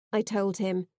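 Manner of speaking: usual speaking voice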